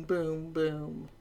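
ordinary delivery

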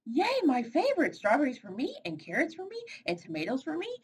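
high, greedy delight; a bird claiming the whole garden; gleeful. Acted. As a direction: squeal over every treat. Birdie finds food in garden.

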